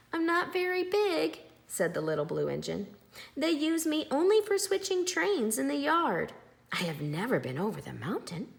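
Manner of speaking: little blue engine shyly explaining herself; small engine voice. A narrator's reading. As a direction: make her sound tiny and modest.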